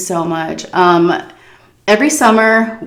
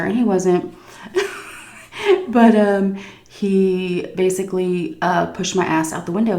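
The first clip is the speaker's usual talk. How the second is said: ordinary talk, nothing marked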